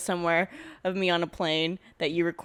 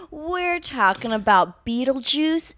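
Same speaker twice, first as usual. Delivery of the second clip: excited; 8 semitones higher, 6 dB louder, slower than usual. Acted. Podcast intro announcement.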